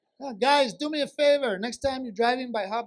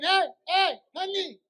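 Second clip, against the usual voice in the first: high-pitched, exasperated yelling; acted outraged yell across a store; yelling to wife; 8 semitones higher, 5 dB louder than usual. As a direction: holler for your honey in high-pitched disbelief. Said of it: "And I'm yelling".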